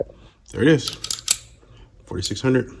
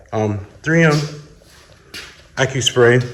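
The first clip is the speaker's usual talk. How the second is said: big hall echo. Real, not acted. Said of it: "Sorry about the reverb".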